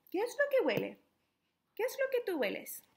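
put-on high, bright question to children; asking the kids. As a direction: lift the voice way up to ask the little ones.

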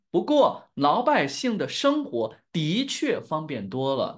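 slow, carefully pronounced standard Mandarin. Shown as a demonstration. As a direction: teach it slowly in standard Mandarin. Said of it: "This is standard Mandarin".

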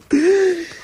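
mock gasp. Shocked at Billy's review.

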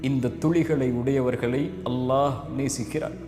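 quiet down into a heartfelt appeal; solemn closing. Acted. Soft closing exhortation and prayer. (மென்மையான, தாழ்ந்த குரலில் உருக்கமான வேண்டுகோள்)

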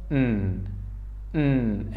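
"N" as a soft nasal hum, "nn"; letter N sound. Shown as a demonstration. Teacher showing the sound of N, soft nasal "nn". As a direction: hum "N" quietly through the nose. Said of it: "is pronounced like".

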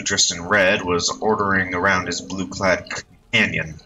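ordinary delivery, nothing marked

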